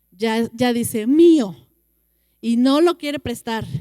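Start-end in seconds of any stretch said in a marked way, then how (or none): 1.0-1.6 put-on loud, higher childish claim; greedy little child yelling mine; possessive toddler. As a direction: shout it like a toddler guarding a toy. Toddler grabbing toy.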